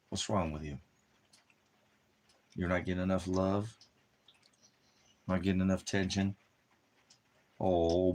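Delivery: cooing, sympathetic pet voice; pet pity talk. Acted. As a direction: coo over the poor dog. Pitying whining dog.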